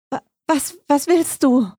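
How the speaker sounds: high, halting, fearful question; scared and stuttering; frightened stammer. A narrator's reading. Frightened girl confronts the stranger.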